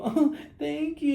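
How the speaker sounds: high, slow, smiling simper; mock flattered woman. Smiling woman to the stranger as her man watches, mock flattered. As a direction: imitate her beaming at a compliment.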